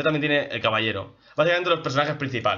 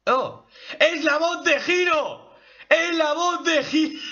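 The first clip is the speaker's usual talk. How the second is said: thrilled discovery; 14 semitones higher than usual. Real, not acted. Fan recognising the voice of Hiro, thrilled. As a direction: cry out in real disbelief.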